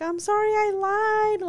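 high, drawn-out tearful confession, mimicked; mock wailing apology, slow and high; imitated crying. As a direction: mimic a crying child owning up.

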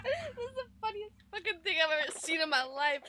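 high squeal through laughter; tearful laughing. Real, not acted. Co-host watching podcaster suffer hot sauce, crying with laughter. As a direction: barely get it out for laughing.

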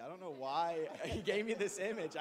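laughing through the words. Real, not acted. Speaker laughs at her own odd vision. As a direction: giggle while admitting it's odd. Laughing about coffee with Jesus.

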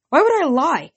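high indignant retort; indignant protest. Acted. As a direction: push back, offended. Percy protesting.

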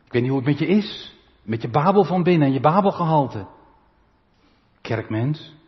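ordinary talk

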